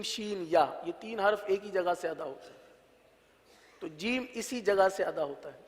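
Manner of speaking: ordinary talk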